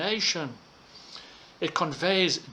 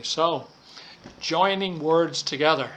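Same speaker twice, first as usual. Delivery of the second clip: usual speaking voice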